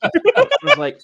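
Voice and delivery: high laugh before speaking. Real, not acted. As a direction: laugh it out, amused. Laughing at himself retelling.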